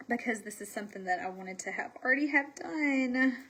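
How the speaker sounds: usual speaking voice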